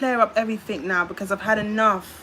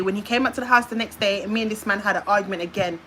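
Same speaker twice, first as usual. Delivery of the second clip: normal talking voice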